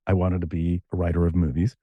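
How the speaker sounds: normal talking voice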